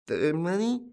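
high, dim questioning tone; dopey, rising question; stupid bewilderment. A narrator's reading. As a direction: repeat it as if witless. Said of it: "repeated the tramp with a stupid look".

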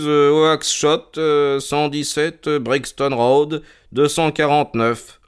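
slow, halting read-out from a ledger; reading out slowly. A narrator's reading. Holmes reads the ledger entry aloud, slow and halting. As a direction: spell it out slowly as you read. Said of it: "lut Holmes".

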